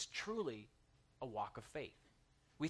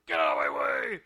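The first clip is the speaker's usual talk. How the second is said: lower, much louder shout at the car ahead; mock road rage yell; 13 dB louder than usual. Preacher acting out yelling at a driver: mock road rage shout.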